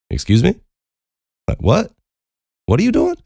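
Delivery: high, incredulous questioning; taken aback. Retold or imitated. Teller re-enacts reacting to her confession, taken aback. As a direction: pitch climbing with each shocked question.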